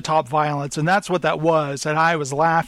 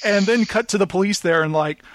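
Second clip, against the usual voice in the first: ordinary talk, nothing marked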